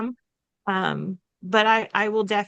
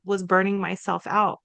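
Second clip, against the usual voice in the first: ordinary talk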